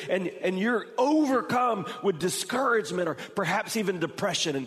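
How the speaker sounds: high, loud pleading sermon voice; preaching at full force. Acted. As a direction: press the questions home to the congregation.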